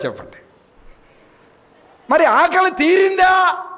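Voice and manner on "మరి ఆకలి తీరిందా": high, slow, louder rhetorical question; preacher's high pleading call; challenging cry. Acted. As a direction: fling the question at the crowd, drawn out.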